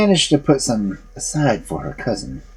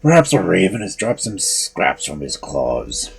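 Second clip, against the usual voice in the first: ogre voice; 5 semitones lower than usual. A narrator's reading.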